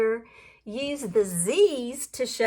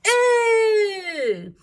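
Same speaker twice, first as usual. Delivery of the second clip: higher, louder hammed-up squeal; mock scream; 6 semitones higher, 3 dB louder than usual. Reading the hog getting sprayed, a playful mock scream. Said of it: "who screamed".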